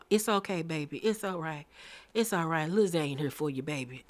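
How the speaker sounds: soft, cooing mock comfort. Mocking Zane soothing Scrappy, soft cooing voice. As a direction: coo it to him, teasing the sweetness. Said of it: "here goes Zane hugging him".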